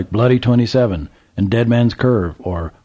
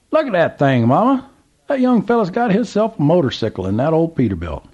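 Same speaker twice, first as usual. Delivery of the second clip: put-on old Southern gentleman; 9 semitones higher than usual. A narrator's reading. Old man calls to trucker, high Southern voice. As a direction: play the curious old man at the gas pump.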